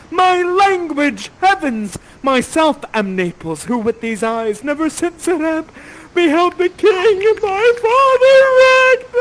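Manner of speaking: high, loud theatrical outcry rising to a wail; crying out. Acted. Ferdinand hears his own language and cries out, melodramatic. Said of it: "You cry out".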